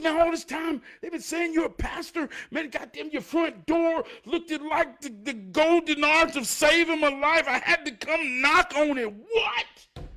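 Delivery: very high, loud falsetto pleading rant; frantic neighbour. Retold or imitated. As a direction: shout it up in a squeaky frantic neighbour voice. Desperate neighbour at the door.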